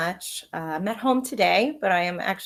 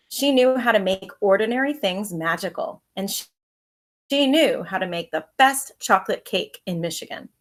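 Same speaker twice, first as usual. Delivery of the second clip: lively read-aloud storytelling voice; story narration; 8 dB louder than usual. A narrator's reading. Teacher reading a picture book to her pupils, lively storytelling.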